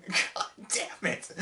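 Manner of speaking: slow, breathless whispered curse; real voiceless exhausted curse; exhausted mutter. Real, not acted. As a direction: voice spent after ranting. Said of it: "I lose my voice".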